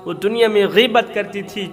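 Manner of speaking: ordinary talk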